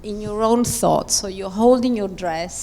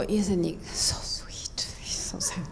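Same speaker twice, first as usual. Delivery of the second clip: adoring whisper; 3 semitones lower, 7 dB quieter than usual. Shown as a demonstration. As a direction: breathe it out, lost in love. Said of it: "and you go".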